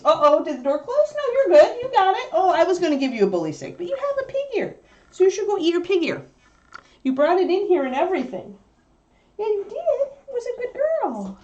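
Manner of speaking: high, sing-song talk to the puppy; dog talk. Real, not acted. Owner reassuring puppy about her pig ear, high sing-song.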